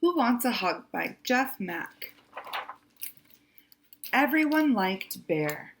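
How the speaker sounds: usual speaking voice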